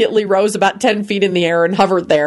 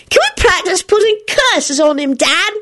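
high, excited ask; boy asking to curse Kenny; eager shout; 12 semitones higher than usual. A narrator's reading. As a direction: call out to dad eagerly. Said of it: "shouted Teddy".

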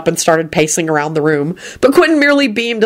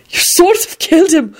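put-on high, giggly old gent barely holding it together; a high, chortling voice for Quentin; Quentin's laughing voice; 11 semitones higher than usual. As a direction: play Quentin tickled by his own mischief. Quentin chortling over killing story.

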